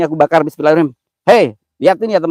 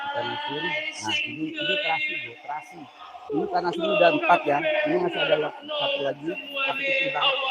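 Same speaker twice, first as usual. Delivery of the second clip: much softer, higher, hushed; hushed mock-spooky narration over burning offerings; hushed ritual voice; 12 semitones higher, 10 dB quieter than usual. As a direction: murmur as if spirits might hear.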